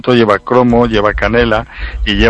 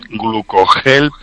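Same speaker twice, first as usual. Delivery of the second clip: slow and deliberate; much slower than usual. Real, not acted. Repeating product name for listeners.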